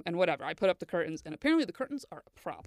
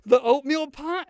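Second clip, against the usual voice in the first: mock surprise; 5 semitones higher, 11 dB louder, slower than usual. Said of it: "I was like".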